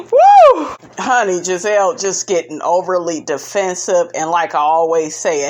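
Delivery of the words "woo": loud, very high whoop of delight; whooping cheer. Real, not acted. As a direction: let out a big celebratory woo. Cheering after the laugh.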